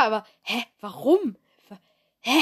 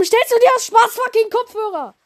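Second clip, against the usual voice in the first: much higher, louder disbelieving yell; incredulous shout; 10 semitones higher, 5 dB louder than usual. Real, not acted. Outraged at the silly purchase.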